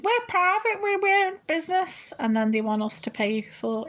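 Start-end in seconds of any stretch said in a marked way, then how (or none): 0.0-2.1 mocking company voice. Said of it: "The water companies are saying".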